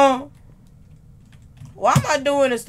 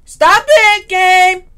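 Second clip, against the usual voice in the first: louder, higher plea to the game; scared yell; 4 semitones higher, 9 dB louder, much slower than usual. Real, not acted. Scared player yelling at game.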